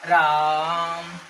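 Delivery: drawn-out call of राम, vowel held long; prolonged calling. Shown as a demonstration. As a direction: call राम across the village, stretching the आ. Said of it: "जैसे हम लोग गाँव में बोलते हैं".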